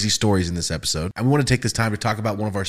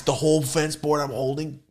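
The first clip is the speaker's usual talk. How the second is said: ordinary talk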